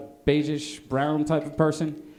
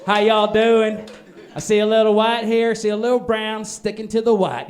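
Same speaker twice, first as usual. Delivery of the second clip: put-on Southern American accent; 3 semitones higher, 4 dB louder than usual. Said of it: "Southern American".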